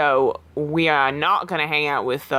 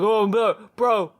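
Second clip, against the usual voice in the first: higher, quick, hyped gym bro; 4 semitones higher than usual. Acted. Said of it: "you have to say in the bro voice".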